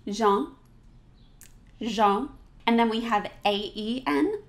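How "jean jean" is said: Jean with nasal ah vowel, no n; French Jean. Shown as a demonstration. Teacher modeling Jean for class, nasal ah. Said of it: "So say that with me".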